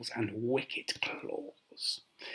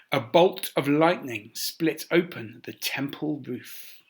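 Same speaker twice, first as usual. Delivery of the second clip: ordinary delivery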